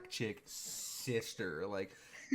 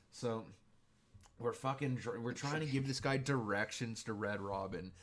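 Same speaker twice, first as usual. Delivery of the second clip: usual speaking voice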